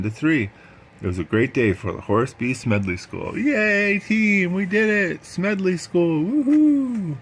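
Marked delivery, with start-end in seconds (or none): 3.4-7.2 very high, excited victory cheer. Acted. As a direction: cheer the team's win. Celebrating the victory.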